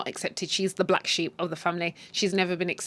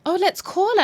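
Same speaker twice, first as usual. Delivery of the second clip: mock sweet voice; 11 semitones higher, 4 dB louder than usual. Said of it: "she's like".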